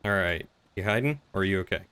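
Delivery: normal talking voice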